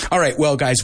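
usual speaking voice

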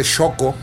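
Xoco said "Shoco", X as sh; sh sound. Shown as a demonstration. Giving viewers a second example of sh, Xoco. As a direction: start Xoco with a clear sh. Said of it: "utilizamos el fonema sh".

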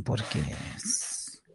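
breathy whisper, slowed down. Real, not acted. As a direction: drop to a hushed aside. Drops to a whisper mid-chat.

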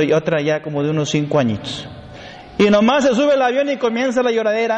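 ordinary talk

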